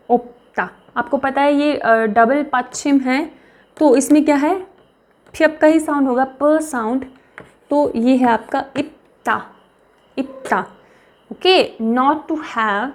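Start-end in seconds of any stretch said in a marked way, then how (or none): none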